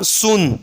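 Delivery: સૂન with a long oo vowel; long double-O sound. Shown as a demonstration. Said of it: "double ઓનો દીર્ઘ ઓ લાગશે".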